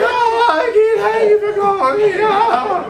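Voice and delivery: high, loud, tearful cry-shout; crying, upset and yelling. Retold or imitated. Imitating a caller on the phone, crying and yelling at once. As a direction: wail it out like she's sobbing and yelling at once. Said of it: "she always sounds like she's, like, crying and upset and yelling".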